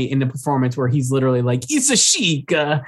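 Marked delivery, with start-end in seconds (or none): 1.7-2.5 exaggerated Italian lilt, pitched up; Italian accent. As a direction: toss it off like a Gucci heir. Jared Leto line quoted.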